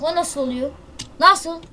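normal talking voice

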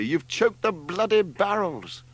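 high, slow, outraged accusation; furious yell. A narrator's reading. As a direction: draw out each furious word. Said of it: "yelled Leslie furiously".

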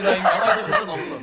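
loud laughing protest; laughing loudly. Real, not acted. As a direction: laugh through the words. Laughing protest about feet.

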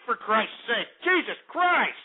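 ordinary talk

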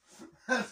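quick breathy laugh at himself; short laugh. Real, not acted. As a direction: laugh at your own swearing. Laughs at own cursing.

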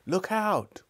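high, sudden warning cry; warning shout. Acted. Crowd warning the policeman of the kick, shouting high. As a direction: yell a warning. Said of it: "called several voices".

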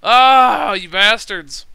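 real loud, exasperated yell; frustrated yell. Real, not acted. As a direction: shout at the people who fooled him. Realizes he was worked.

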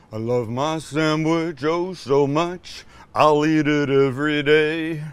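a hint of the King, drawn out. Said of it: "my horrible [name] impression".

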